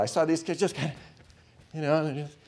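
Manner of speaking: ordinary talk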